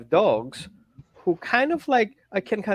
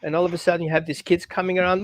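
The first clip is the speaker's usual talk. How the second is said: ordinary talk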